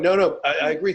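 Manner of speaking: usual speaking voice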